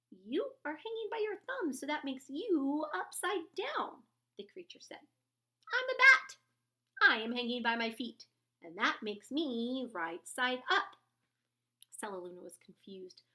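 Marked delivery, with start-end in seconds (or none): 0.1-4.0 high, loud insistent bat. A narrator's reading. 5.7-11.0 proud bat voice. A narrator's reading.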